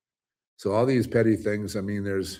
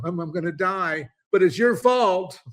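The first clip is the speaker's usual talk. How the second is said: spiteful dying man; 10 semitones higher, 5 dB louder than usual. Retold or imitated. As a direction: play a petty man blaming others as he dies. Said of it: "What are you gonna do?".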